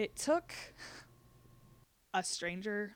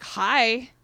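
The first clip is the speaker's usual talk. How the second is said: a stiff, overly bright "Hi", loud and high; awkward loud hi; 5 semitones higher, 16 dB louder than usual. Retold or imitated. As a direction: greet her far too cheerfully to cover the tension. Sister calls after the email.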